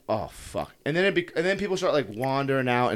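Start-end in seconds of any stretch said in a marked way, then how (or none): 0.1-0.7 low, soft swear; dismayed self-talk. Retold or imitated. Man stranded on the tarmac to himself, quiet dismay. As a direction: let it sink, quiet and low.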